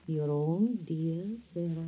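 slow, soft syrupy voice; sugary sweet. Acted. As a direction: coo it with fake sweetness. Fake sweetness to dying aunt.

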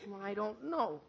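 soft, slow dejection; deflated teenage bewilderment; crestfallen. Acted. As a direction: let the disappointment sink in quietly. Archie stunned by broken date.

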